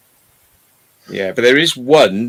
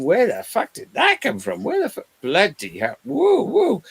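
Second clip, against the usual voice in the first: high startled yelps of disbelief; mock alarmed squeals, pitched way up; shocked exclaiming; 11 semitones higher than usual. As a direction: react like something just shot out of your nose. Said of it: "I was going".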